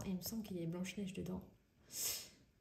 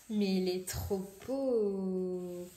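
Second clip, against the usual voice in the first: drawn-out delight; 5 dB louder, much slower than usual. Real, not acted. Admiring a page of the book.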